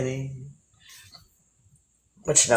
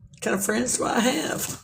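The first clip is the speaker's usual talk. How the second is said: mock-sad whisper aside; a whispered grumble about friends; whispered lament; 7 semitones higher, 6 dB quieter than usual.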